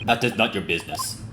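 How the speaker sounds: quiet "That is not your business" in a lightly Russian guard voice; a hint of a Russian accent, shutting the question down. Guard refusing to answer, light Russian accent. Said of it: "me voicing him is also a little bit stereotypical".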